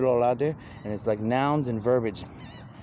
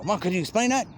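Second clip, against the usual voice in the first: put-on high childlike ask; 9 semitones higher than usual. Voicing himself asking mom.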